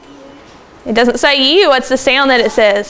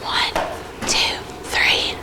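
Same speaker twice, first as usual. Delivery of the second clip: soft unvoiced whisper for the class; hushed classroom whisper; 10 dB quieter, much slower than usual. Shown as a demonstration. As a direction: whisper the count, then the word. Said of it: "In a whisper voice".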